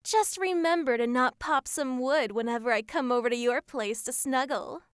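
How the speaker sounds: ordinary talk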